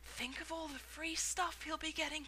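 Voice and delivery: envious. A narrator's reading. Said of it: "another boy said enviously".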